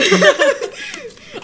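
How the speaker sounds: high, loud burst of laughing; loud laughter. Real, not acted. Host at own leprechaun joke, loud high laughter. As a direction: crack up in a high peal.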